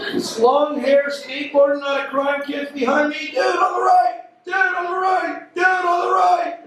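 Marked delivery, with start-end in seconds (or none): 3.3-4.2 raised, higher call; mock warning call. Calling warning to kid. 4.4-6.6 hammed-up warning shout. Repeated calling warning, shouted.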